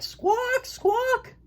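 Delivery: high, squawking penguin call. Acted. Penguin fathers answering their egg, high squawks. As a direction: squawk back like a proud penguin. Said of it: "Roy and Silo called back".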